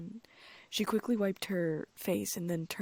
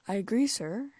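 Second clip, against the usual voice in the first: fake polite agreement; 4 semitones higher, 7 dB louder than usual. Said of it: "making Ron drop his books in surprise".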